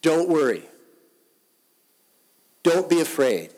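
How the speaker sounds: loud, urgent preaching of the words; forceful proclaiming. Acted. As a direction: call it out like a command of comfort. Pastor urges congregation with Jesus' words.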